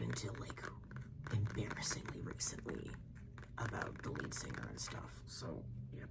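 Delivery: soft secretive whisper; breathy ASMR-style whisper. Acted. Whispered confession about the band.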